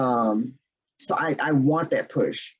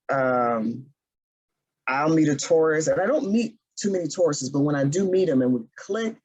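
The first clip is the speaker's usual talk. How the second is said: ordinary talk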